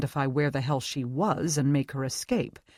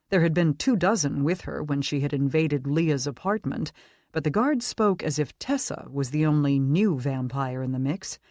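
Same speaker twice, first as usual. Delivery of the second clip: plain reading voice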